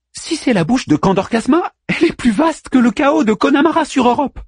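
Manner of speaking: high-pitched eager figure; Manmut's excited voice. A narrator's reading. Manmut describes the opening to Orpheus, high and excited.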